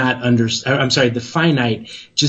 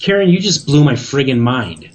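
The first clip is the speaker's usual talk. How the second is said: ordinary delivery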